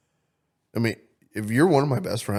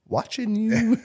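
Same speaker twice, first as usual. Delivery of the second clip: high, drawn-out creepy voice; playfully creepy; 12 semitones higher, slower than usual. Pretend. Guy who snoops teasing his friend, mock creepy. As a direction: tease like a lurking creep, pitched way up. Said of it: "I can see you doing that creepy ass".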